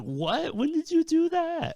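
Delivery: high, loud incredulous squeal; mock shocked reaction to a friend's news; retold surprise. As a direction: shoot up in pitch, stunned by the news. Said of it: "I was like".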